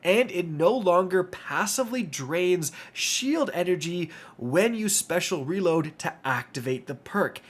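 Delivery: ordinary talk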